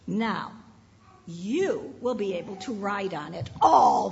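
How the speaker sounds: put-on expressive read-aloud for kids; storybook reading. A narrator's reading. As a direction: read it like story time on the rug. Reads a story to children.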